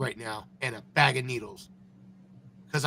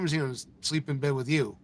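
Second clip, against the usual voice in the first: ordinary talk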